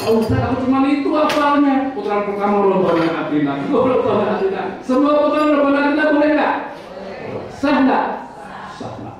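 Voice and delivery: high-pitched, loud imitation of a questioning pilgrim; put-on high voice, checking if it is allowed; pilgrim asking ustaz.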